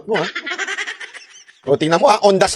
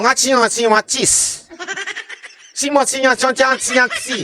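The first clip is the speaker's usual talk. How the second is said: a light, joking imitation of Chinese speech, slightly higher; mock Chinese gibberish; 6 semitones higher than usual. Vlogger mocking how Xi Jinping spoke, light mock Chinese babble. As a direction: playfully mimic how Xi Jinping talked. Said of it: "paano niya sinabi?".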